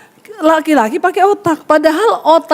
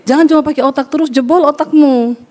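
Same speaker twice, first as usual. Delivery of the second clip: ordinary delivery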